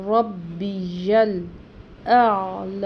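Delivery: ربی یل اعلی with the syllable یل held separately, slow; correct yal. Shown as a demonstration. Teacher showing the correct syllables, slow. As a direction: spell out ربی یل slowly then اعلی. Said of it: "یا پڑی زبر لام ساکن یل".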